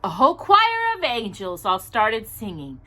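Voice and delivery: gentle rhyming storybook read; reading to children. Acted. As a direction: read the picture book aloud to little listeners. Reads a Christmas storybook aloud.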